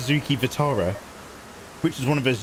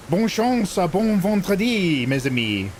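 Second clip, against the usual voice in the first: hammed-up French; 5 semitones higher than usual. Hammed French greeting to chat.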